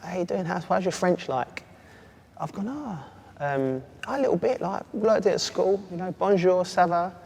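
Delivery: a keen new signing trying his French; naive newcomer. Retold or imitated. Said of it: "And then I'm like".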